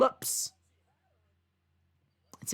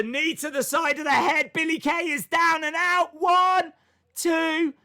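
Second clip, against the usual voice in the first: high, loud play-by-play shout; excited match call; 10 semitones higher, 5 dB louder than usual. Real, not acted. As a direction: call the pinfall at full excitement. Live call of a near pinfall.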